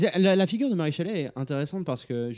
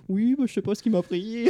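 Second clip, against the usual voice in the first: high, sheepish apologetic voice; sheepish Mary Shelley; 8 semitones higher than usual. Retold or imitated. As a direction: play the embarrassed writer shrugging it off. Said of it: "elle dit".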